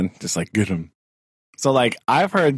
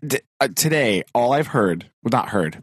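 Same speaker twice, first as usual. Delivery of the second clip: ordinary delivery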